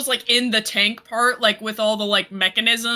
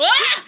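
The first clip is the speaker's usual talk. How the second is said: high startled yelp of effort; strained cry; 4 dB louder than usual. Real, not acted. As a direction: yelp as you take the weight. Strains lifting Celine.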